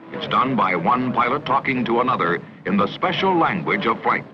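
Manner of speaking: ordinary talk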